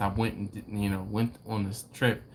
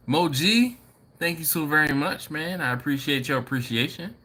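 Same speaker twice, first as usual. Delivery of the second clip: normal talking voice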